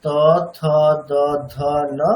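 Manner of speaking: ত, থ, দ, ধ, ন said one by one with the tongue on the teeth; teacher modelling the dental row; dental series demo. Shown as a demonstration. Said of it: "ত বর্গের সাহায্য নিয়ে অভ্যাস করব".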